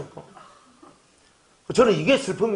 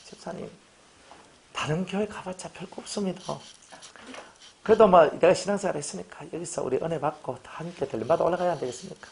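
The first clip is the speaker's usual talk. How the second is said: softer, coaxing pastor voice; gentle coaxing; 6 dB quieter than usual. Retold or imitated. Pastor softly pleads with a deacon leaving church. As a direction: talk him round quietly and kindly.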